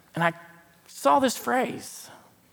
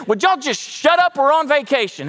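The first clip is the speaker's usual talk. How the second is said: higher, louder mock yell at the kids; exasperated parent; 6 semitones higher, 3 dB louder than usual. A parent an hour into the family trip yelling at the grumbling kids, mock exasperated. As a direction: snap at the back seat like a fed-up parent.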